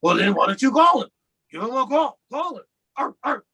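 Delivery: mock raspy voice ending in growled barks. Buddy urging him to call the sponsor, imitated raspy voice. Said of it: "he has his deep raspy voice".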